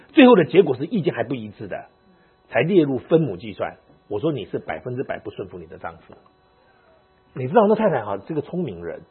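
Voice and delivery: usual speaking voice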